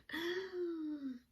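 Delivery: high, soft drawn-out gasp; long gasp. Real, not acted. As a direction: gasp and let out a high, airy squeal. Reaction to feel-good song question.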